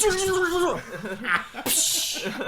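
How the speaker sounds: high trilled rumble ending in a burst; mouth-made special-move sound, rising into a crash; imitated game sound effect. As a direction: mimic a fighting-game super move.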